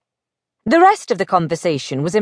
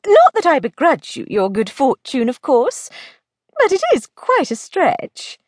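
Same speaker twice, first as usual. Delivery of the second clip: higher-pitched, sceptical lady; doubtful lady friend; 5 semitones higher than usual. Acted. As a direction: lightly play a friend who finds it hard to believe. Plays Belle, doubtful friend.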